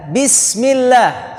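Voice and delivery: slow, drawn-out bismillah; teacher chanting the prayer; recitation demo. Shown as a demonstration. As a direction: recite it slowly as a model for the class. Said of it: "Caranya begini".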